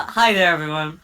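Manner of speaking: normal talking voice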